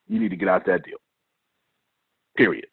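low, soft warning; forcing a deep voice. Shown as a demonstration. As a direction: drop the voice deep and quiet. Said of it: "Let me deepen my voice for dramatic effect".

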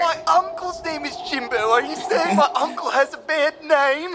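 high, strained holler without much volume; indignant distant call. Acted. Lookout defending an uncle's name from the crow's nest, high indignant call. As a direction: call down in high-pitched protest.

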